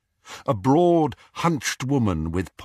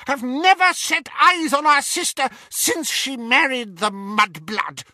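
high, loud, desperate protest; crying out; 4 dB louder than usual. Acted. Bellatrix pleading to her lord over the laughter, crying out. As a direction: cry out over the laughter in shrill panic.

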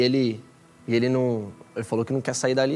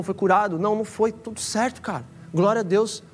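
raised, fervent preacher's voice; preaching; 5 semitones higher than usual. Acted. Pastor urging listeners not to fear failing, preaching. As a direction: urge the crowd to lose their pride.

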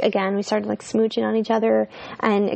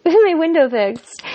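laughing; 6 semitones higher, 5 dB louder than usual. Real, not acted. Laughs while telling it.

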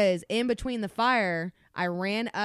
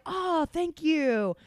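sarcastic thanks; 12 semitones higher, 9 dB louder than usual. Retold or imitated. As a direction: thank them with dripping sarcasm. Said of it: "I'm like".

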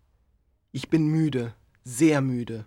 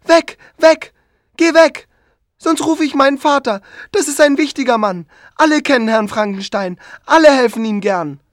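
put-on screaming child; 8 semitones higher, 9 dB louder than usual.

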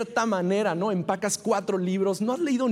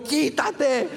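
mock shout; 7 semitones higher, 3 dB louder than usual. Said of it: "Le dice a su mejor amigo".

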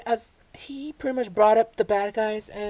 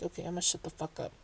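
much softer, fast, under the breath; muttered resignation; 4 semitones lower, 15 dB quieter, faster than usual. Retold or imitated. Vlogger quietly mutters giving up on arguing with Kevin. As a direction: swallow the words and back down. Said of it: "so I'm like".

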